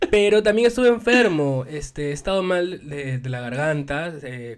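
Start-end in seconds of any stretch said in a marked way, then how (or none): 0.1-1.7 laughing. Real, not acted. Laughing at interruption.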